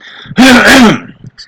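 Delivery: harsh loud throat clearing; smoker's cough. Real, not acted. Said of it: "Smoker's cough's back".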